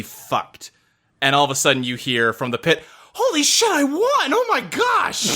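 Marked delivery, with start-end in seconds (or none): 3.1-5.3 high, shrill-free yelp of a winning gambler; excited gambler shout. Acted. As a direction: call out wildly from across the room.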